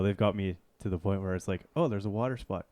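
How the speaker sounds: ordinary delivery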